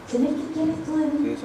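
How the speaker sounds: slow, soft plea to God; quiet prayer. Retold or imitated. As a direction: pray it quietly and slowly. Praying to the Lord. (oración suave y lenta)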